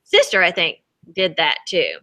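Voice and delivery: ordinary delivery, nothing marked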